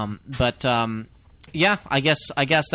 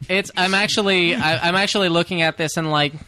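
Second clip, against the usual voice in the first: ordinary talk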